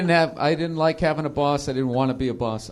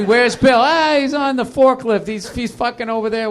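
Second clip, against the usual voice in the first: raised, louder mock warehouse shout; calling out; 8 semitones higher, 6 dB louder than usual. Warehouse workers looking for Bill, calling out. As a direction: call across the warehouse, higher and louder.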